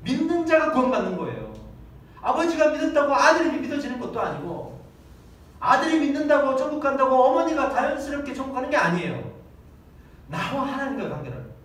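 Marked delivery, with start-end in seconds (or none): 0.0-9.4 raised, loud preaching voice; emphatic preaching. Acted. Preacher stressing faith to the congregation, raised and emphatic. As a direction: drive the doctrine home from the pulpit.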